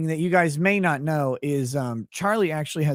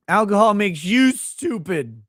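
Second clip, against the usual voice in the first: "Alcohol makes you stupid" said slower and louder, mock offended; mock indignant repeat; 5 semitones higher, 6 dB louder than usual.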